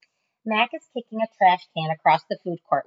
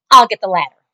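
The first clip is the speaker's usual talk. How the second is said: loud eager announcement; 4 semitones higher, 13 dB louder, faster than usual. Acted. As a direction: blurt out the plan. Julia eager plan.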